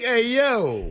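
"Hey, yo" thrown up high as a buddy greeting; mock greeting. Host teasing Patty about greeting his boys, mock high voice. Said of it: "be like".

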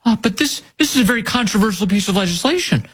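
mocking high-pitched interviewer voice; high mock-concerned objection; Margaret Brennan. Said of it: "Margaret Brennan said".

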